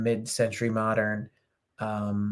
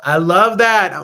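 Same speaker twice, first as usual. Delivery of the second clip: louder, higher delighted exclamation; 6 semitones higher, 9 dB louder, slower than usual. Real, not acted. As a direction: cheer the idea out loud. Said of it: "L O L".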